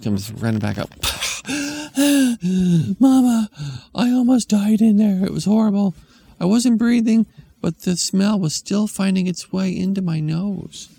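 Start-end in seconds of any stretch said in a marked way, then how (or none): 0.9-2.9 big gasp. Acted. Kid gasping after holding breath in the bathroom, high gasp. 3.0-10.9 put-on high, loud breathless child; dramatic kid. Kid telling mom the bathroom was horrible, loud high cry.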